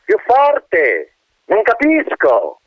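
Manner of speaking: high, slow, peevish wind voice; disappointed wind. Acted. Wind asks the colonel to speak up, peevish. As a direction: whistle it out, annoyed and slow. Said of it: "con un tono disdettoso".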